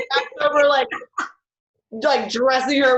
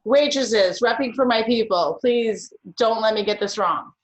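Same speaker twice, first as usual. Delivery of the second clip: ordinary talk, nothing marked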